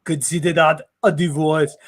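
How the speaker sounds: hammed-up Austrian one-liner; bad German accent. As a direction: imitate an Austrian action hero. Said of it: "lots of really bad German accents".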